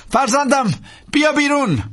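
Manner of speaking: high, firm call to the pilot; priest giving an order. A narrator's reading. Priest ordering the pilot out, high firm call. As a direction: tell the pilot to get out.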